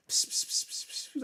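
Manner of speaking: breathy whispered pss sounds; whispering the method. Retold or imitated. Watching himself do the method, whispering. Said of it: "I'm sat there going".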